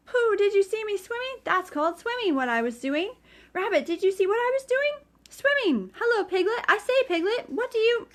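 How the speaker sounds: high squeaky excited voice; excited squeak. Acted. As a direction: squeak it proudly. Roo boasting to everyone.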